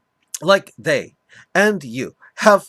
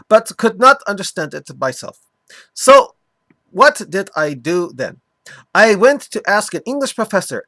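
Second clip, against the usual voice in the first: mock Arabic accent. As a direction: lecture it in a hammed-up Arabic accent. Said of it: "continue doing my mock Arabic accent for him".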